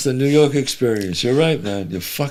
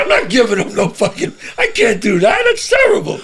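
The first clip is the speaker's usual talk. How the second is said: higher and indignant, hammed-up; mock outraged dad; 8 semitones higher than usual. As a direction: squeal the refusal, mock shocked. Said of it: "I said".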